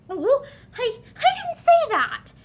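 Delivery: upset denial; tearful protest. A narrator's reading. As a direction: protest through tears.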